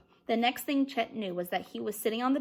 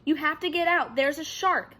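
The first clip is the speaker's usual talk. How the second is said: urgent warning; 5 semitones higher, 5 dB louder than usual. A narrator's reading. Chet shouting at friends.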